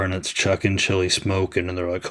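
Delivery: ordinary talk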